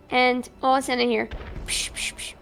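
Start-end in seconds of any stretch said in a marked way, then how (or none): 1.7-2.3 soft unvoiced psh gunshot noises; mock gunshots. Cop imitating distant gunfire while retelling. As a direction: breathe out three quick gunshot sounds. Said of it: "pretty good sound effects right there".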